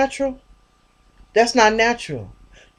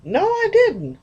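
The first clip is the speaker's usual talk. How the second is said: pitched way up, protesting; high mock denial; 11 semitones higher, slower than usual. Friend denying the lottery win, high protest. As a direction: squeak out the no. Retold denial back and forth.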